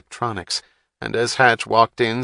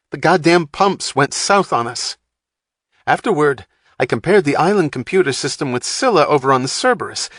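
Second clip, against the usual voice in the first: much higher, louder, fast rant; agitated rant; 6 semitones higher, 5 dB louder than usual. A narrator's reading. Wapner ranting at Hatch about the crash, agitated. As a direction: vent the frustration.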